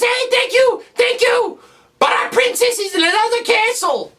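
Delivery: very high, squeaky falsetto; little kid. Acted. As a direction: squeak it like a tiny rescued kid. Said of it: "there's this little kid in the cage".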